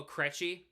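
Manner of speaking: "Krejci" with short e, as KRETCH-ee; mispronounced Krejci. Retold or imitated. Streamer quoting names said wrong, KRETCH-ee for "Krejci". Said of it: "when people say the name wrong".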